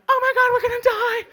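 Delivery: high, loud laughing panic; panicked. Retold or imitated. Security guards seeing the crowd wave, high laughing panic. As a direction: squeal it like terrified teenage boys. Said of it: "they were like".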